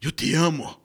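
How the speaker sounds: sudden loud love confession in a put-on voice; love confession shouted in a strange voice; loud declaration. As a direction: blurt out I love you. Love confession in acted scene.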